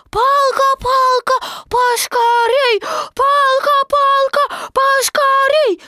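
put-on high, loud, drawn-out child; a small joey worried his stick is lost; squeaky fretful kid; little Roo voice. A narrator's reading. Roo chanting cheer.

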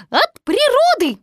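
very high, loud little squeak; Piglet. A narrator's reading. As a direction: explain in a tiny squeaky voice. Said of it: "сказал Пятачок".

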